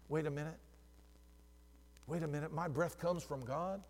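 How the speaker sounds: low, soft, as if it just dawns; hushed realisation. Acted. Pastor remembering God gave him breath, hushed realisation. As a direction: drop right down, pausing as the thought lands.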